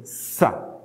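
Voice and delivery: "sa" soft and low, hissed S; isolated S sound. Shown as a demonstration. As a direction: isolate "sa" with a voiceless S. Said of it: "esse som de S".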